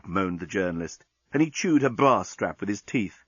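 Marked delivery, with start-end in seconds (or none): none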